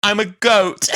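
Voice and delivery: high, loud declaration to the camera; hammed-up shouted confession; mock shout. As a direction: belt it out as the punchline. Rose delivers the line to camera.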